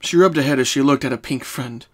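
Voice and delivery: ordinary delivery, nothing marked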